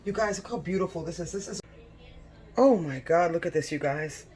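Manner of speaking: normal talking voice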